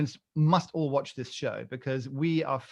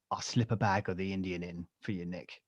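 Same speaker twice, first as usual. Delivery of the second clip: low, hushed shady-dealer voice; drug dealer impression; 6 semitones lower, 7 dB quieter than usual. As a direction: imitate a dealer making a discreet offer. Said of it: "you sound like a drug dealer".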